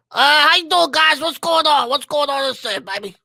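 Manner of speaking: high, loud Beetlejuice-style character voice; Beetle. Acted. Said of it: "with Beetle, when he wakes up now".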